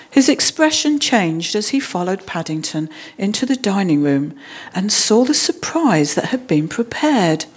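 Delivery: ordinary delivery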